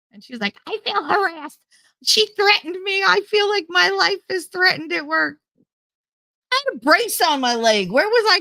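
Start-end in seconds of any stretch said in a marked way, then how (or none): 0.7-5.4 high-pitched, hammed-up aggrieved complaint; mocking, indignant plaint; mock victim. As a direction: imitate a coworker playing the victim, pitched way up. Mimicking aggrieved coworker's complaint.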